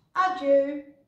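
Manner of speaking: high, loud goodbye; calling farewell. A narrator's reading. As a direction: call a cheery farewell after someone. Farewell called out on leaving. (uitroepend afscheid)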